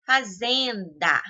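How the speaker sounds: Fazenda slowly, with ZEN stretched; stress on middle syllable. Shown as a demonstration. Teacher giving a paroxytone example, stress on ZEN in Fazenda. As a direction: lean long on the -zen- of Fazenda. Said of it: "Zen, aí é mais forte".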